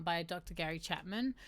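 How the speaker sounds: ordinary delivery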